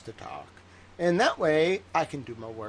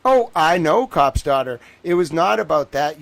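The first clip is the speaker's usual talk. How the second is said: much louder, defensive retort; loud protest; 3 semitones higher, 13 dB louder than usual. Real, not acted. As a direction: cut them off loudly.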